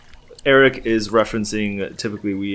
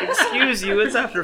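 mock offended laugh; 13 semitones higher than usual. Real, not acted.

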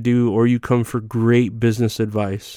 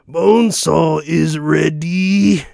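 higher, slow, hammed-up wrestler promo; mock pro-wrestler catchphrase; wrestling promo voice; 6 semitones higher, slower than usual. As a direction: announce it like a ring entrance. Wrestling catchphrase after tribute.

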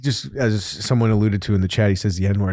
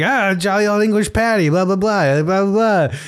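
slightly raised rambling mock voice; imitated rambling; 6 semitones higher than usual. Retelling how the guest named himself, mock rambling. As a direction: lightly imitate someone babbling on about himself. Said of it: "He started calling himself".

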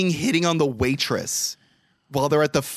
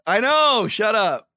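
sheepish protest; 10 semitones higher, 4 dB louder, slower than usual. Real, not acted. Host defends self.

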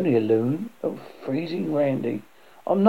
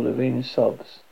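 ordinary delivery

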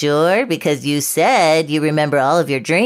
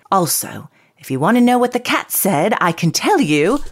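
put-on English accent; 2 semitones higher than usual. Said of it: "I'm an English short-haired pointer".

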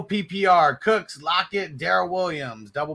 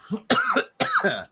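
coughing fit. Real, not acted. After smoking, coughing.

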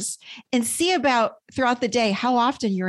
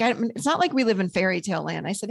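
normal talking voice

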